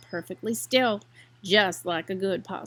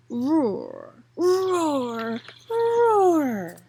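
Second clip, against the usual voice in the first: slow, rising growled roar; building roar, each one louder; 3 semitones higher, 3 dB louder, much slower than usual. A narrator's reading. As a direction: growl it out like a swamp cat. Narrator imitates creature roar.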